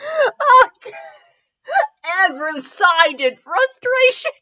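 high, breaking laughing read; slow, squeaky words through laughter; helpless laughter. Real, not acted. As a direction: try to reread it while cracking up.

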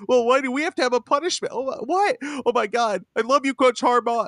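very high, whiny fake weeping; mock crying. Podcaster mocking Michigan crying after getting caught, mock crying. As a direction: wail like a caught fan feeling sorry for himself, hammed-up. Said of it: "You can't cry whenever you finally get caught".

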